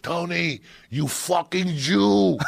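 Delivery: high, slow mock yell from behind the counter; imitated yell. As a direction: bellow it like the angry pizza man. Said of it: "he'd yell at the kid".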